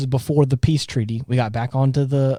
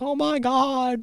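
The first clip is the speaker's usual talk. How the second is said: high, softer, slow mock sob; mock crying Minmay; 11 semitones higher, 6 dB quieter, slower than usual. Retelling Minmay crying, a high, soft mock sob. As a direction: lightly imitate her tearful gasp. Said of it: "she starts crying and is like".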